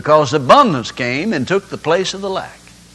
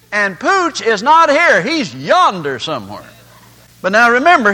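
usual speaking voice

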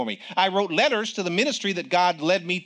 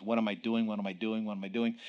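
soft, low, repeated worried question; fading into fear; anxious murmur; 7 semitones lower, 9 dB quieter than usual. Retold or imitated. Frightened young man on a night flight, softly anxious.

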